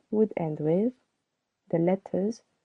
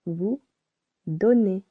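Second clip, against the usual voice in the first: normal talking voice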